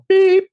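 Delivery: high, loud held beep; piercing mock beep; imitated answering-machine beep. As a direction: mimic a voicemail tone, high and steady.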